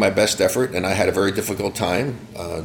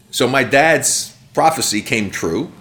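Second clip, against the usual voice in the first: normal talking voice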